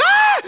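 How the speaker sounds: very high, loud shriek; freaked-out scream. Retold or imitated. Storyteller as a tree flies by, panicked shriek. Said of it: "and I freak out".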